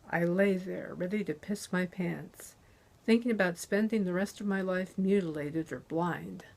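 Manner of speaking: plain reading voice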